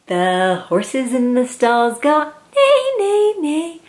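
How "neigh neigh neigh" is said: high whinnying neigh; horse neighs. Acted. Reading to a child, whinnying like a horse.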